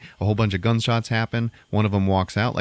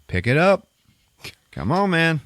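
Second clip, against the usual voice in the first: louder, higher goading challenge; taunting; 10 semitones higher, 4 dB louder than usual. Retold or imitated. Gunslinger goading his rival to draw, loud taunt. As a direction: goad him to try again. Denzel taunting disarmed Bogue.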